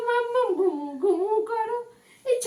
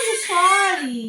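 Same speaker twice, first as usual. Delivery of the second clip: ordinary talk, nothing marked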